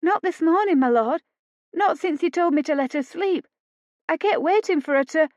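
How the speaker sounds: a frightened maid answering her master; nervous maid. A narrator's reading. Maid to her lord, high and anxious.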